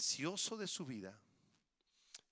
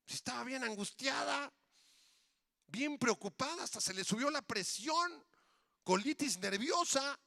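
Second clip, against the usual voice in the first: high-pitched, worked-up fretting; exaggerated anxious exclaiming; mock alarm; 9 semitones higher, 4 dB louder than usual. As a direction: play up the anguish, pitched way up. Recalling her anxiety in mock alarm.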